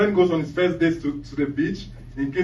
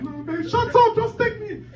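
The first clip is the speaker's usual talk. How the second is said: much higher, louder acted outburst; wife in labor yelling, hammed-up; exasperated wife; 4 dB louder than usual. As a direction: cut him off in a high-pitched impatient yell. Wife in labor snaps at husband.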